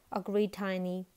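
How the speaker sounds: plain reading voice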